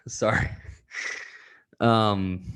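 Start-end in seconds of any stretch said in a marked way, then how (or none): 0.1-0.5 tearful sigh. Real, not acted. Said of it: "starting to tear up a little bit".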